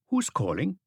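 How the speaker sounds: put-on a cheerful young receptionist answering the phone. A narrator's reading.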